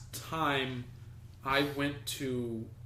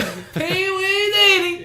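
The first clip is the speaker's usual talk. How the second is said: much higher and louder, slow and laughing; mock fan squeal; 10 dB louder, slower than usual. Friend joking about a celebrity sighting, a laughing high squeal. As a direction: squeal like a starstruck fan, giggling.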